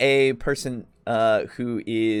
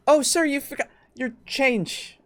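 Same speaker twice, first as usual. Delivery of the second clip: chipper shopkeeper; 12 semitones higher than usual. Acted. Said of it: "shopkeeper calls after customer".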